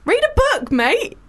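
high smug brag; smug teasing. Real, not acted. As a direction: tease him smugly.

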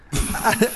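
high, surprised laugh; disbelieving laugh. Real, not acted. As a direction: laugh in shock.

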